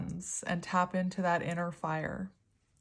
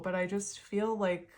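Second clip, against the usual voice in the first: ordinary talk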